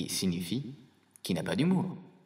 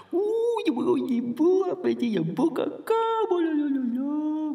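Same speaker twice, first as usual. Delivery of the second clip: very high, loud gushing coo; cooing parents; 5 dB louder than usual. Retold or imitated. Parents marvelling at a newborn's first poo, mock ecstatic cooing. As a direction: gush in a put-on high voice, drawing out the end. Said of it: "je vous entends déjà".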